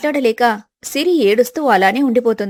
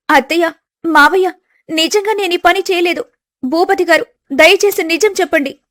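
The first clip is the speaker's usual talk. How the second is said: higher voice, begging to be believed; a wronged bride pleading; young wife pleading; 6 semitones higher than usual. Acted. Wife Siri begging her husband to believe her, higher pleading voice.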